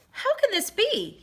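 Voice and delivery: high, astonished cry of wonder; king marvelling aloud at his own joy; amazed cry. A narrator's reading. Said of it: "he cried".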